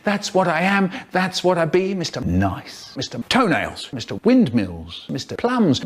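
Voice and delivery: ordinary delivery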